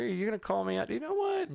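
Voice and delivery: high squeaky mock outrage; mock indignant. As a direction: feign being offended. Mock offense at being called out.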